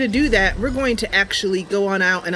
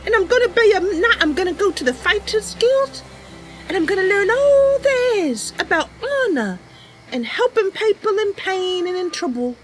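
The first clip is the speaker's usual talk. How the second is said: put-on much higher, eager young Bosmer girl; Mercy's voice; 11 semitones higher than usual. Mercy telling her cat Sasha about the stranger, high eager voice. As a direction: lift way up into a sweet, earnest young knight-hopeful. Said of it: "this is Mercy's voice".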